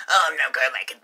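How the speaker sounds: loud put-on whiny friend; random quote voice. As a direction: whine the refusal loudly. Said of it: "he's saying the same thing".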